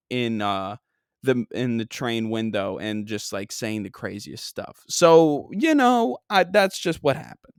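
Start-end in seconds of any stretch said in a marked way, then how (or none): none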